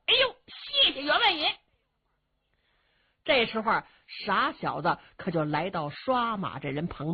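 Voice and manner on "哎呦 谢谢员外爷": put-on grateful young thief. Young thief thanks the squire.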